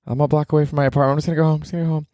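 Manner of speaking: mock-whine the retreat home; lightly voiced lazy inner voice; talking himself out of it. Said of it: "I just, like".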